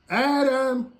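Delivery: loud high call, held; God calling for hidden Adam; calling again. Acted. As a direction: call out, searching. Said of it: "here comes God ... and he says".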